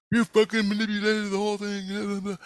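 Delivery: high-pitched heckler imitation; mock yelling. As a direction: yell an accusation in a high voice, without much volume. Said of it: "started yelling at me".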